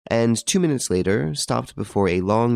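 usual speaking voice

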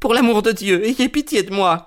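high-pitched, desperate begging; acted frantic supplication; pleading cry. A narrator's reading. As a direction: beg for mercy in a high, panicked voice. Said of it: "cria-t-il".

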